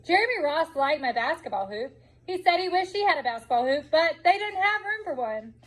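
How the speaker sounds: ordinary delivery, nothing marked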